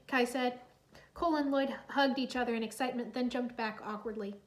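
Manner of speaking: ordinary delivery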